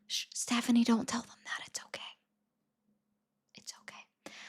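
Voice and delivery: very soft secretive whisper; hushed aside. Pretend. Streamer telling Stephanie not to tell them, whispered. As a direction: whisper it like a secret, fading to near silence.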